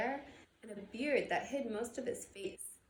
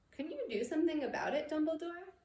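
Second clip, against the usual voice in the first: higher, quicker, anxious plea; pleading McGonagall; 8 semitones higher, 5 dB louder, faster than usual. Acted. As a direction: press him for help, worried.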